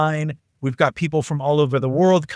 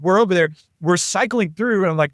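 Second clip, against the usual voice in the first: ordinary talk, nothing marked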